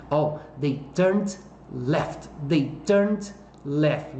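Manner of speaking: teacher repeating the answer slowly for the class; slow careful answer. Shown as a demonstration. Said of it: "slow model answer".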